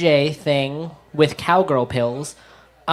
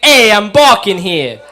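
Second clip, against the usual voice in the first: loud, slow New York chicken squawk; a chicken yelling like a New York cabbie; 4 semitones higher, 10 dB louder, slower than usual. Retold or imitated. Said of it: "my impression of a chicken crossing the streets of New York".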